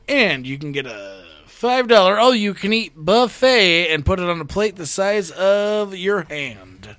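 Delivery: ordinary talk, nothing marked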